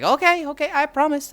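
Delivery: high, loud mock backing down; retold grudging concession. Uncle conceding to his nephew, grudging and high.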